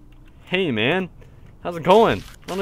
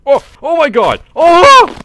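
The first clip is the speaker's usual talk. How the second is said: startled shriek; 12 semitones higher, 14 dB louder, slower than usual. Real, not acted. Startled by something in game.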